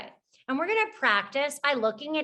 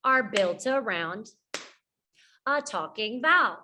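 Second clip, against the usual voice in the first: call-and-repeat phrasing. Shown as a demonstration. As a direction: pause after "Syllables" and "are built around". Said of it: "Can you do this with me".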